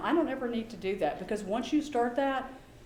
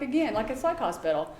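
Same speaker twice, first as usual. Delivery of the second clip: normal talking voice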